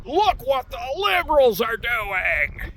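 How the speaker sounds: high, slow mock wail; hammed-up, stretched-out outcry; mock screaming. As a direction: imitate their outraged scream without real volume. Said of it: "see the flyer and scream".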